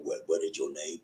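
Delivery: low, soft put-on voice; character voice. Shown as a demonstration. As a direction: drop down low for another character. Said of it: "a bunch of different voices".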